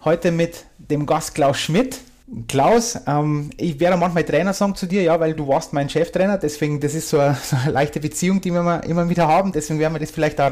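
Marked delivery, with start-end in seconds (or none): none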